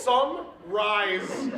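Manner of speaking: "summarizing" drawn out, broken into separate pieces; split into syllables. Shown as a demonstration. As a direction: say "summarizing" slowly like three words. Mocks word as three words.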